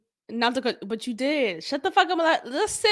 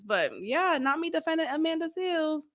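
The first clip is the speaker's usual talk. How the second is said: ordinary talk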